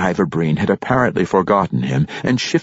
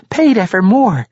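barkeep woman voice; 14 semitones higher, 6 dB louder than usual. Acted. Barkeep explaining to boy.

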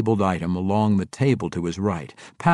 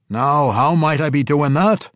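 dwarf voice; 7 semitones higher, 7 dB louder than usual. Acted. Emrys asks.

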